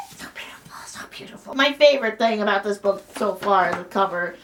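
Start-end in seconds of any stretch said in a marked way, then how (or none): 0.1-1.5 voiceless, awestruck whisper; overwhelmed whisper. Real, not acted. Gushing over the beautiful book, awestruck whisper. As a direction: whisper it as if speechless with delight.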